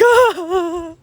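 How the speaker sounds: loud, high, drawn-out fake sob; mock wail. Student sprinting out of class, hammed-up crying wail. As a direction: wail like someone fleeing in tears. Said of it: "I just sprint out".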